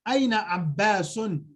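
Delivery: normal talking voice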